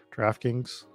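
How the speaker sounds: ordinary delivery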